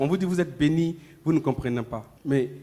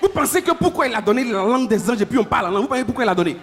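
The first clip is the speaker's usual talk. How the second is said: preaching at full force; 9 semitones higher, 5 dB louder than usual. Acted. Preacher to church, the answer arrives fast: fervent preaching. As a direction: drive the point home to the congregation.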